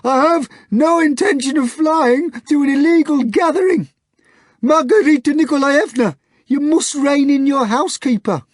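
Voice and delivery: high loud demand in a strange voice; hoarse grunt, half furious. Acted. The hog demanding his face back, high and loud.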